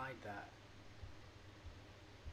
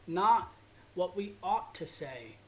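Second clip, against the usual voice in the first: "not" punched much louder, rest slowed; stress on not; 3 semitones higher, 8 dB louder, slower than usual. Acted. Urging viewers to speak honestly, stress on "not". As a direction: hit "not" hard, then ease the rest. Repeating the quote with emphasis.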